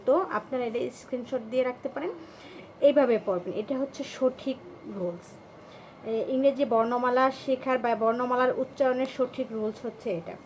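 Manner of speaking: ordinary delivery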